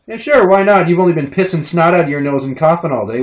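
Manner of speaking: loud, high-pitched mock consent; exasperated parental sarcasm; sarcastic agreement. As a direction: agree sarcastically with a germy kid. Sarcastic answer to snotty toddler.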